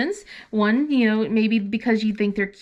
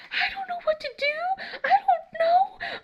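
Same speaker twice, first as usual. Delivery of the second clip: much higher, laughing-crying whimper; panicking daughter. Retold or imitated. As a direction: whine it helplessly like a flustered teen. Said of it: "she's just like".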